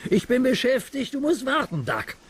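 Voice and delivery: high scornful refusal; rude steamroller George. Acted. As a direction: brush off the engine rudely. Narrator voices George refusing.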